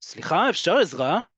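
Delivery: firm, insistent ask for help; a little forceful. Shown as a demonstration. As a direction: press for attention when no one is looking. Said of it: "can sound a little ... more forceful".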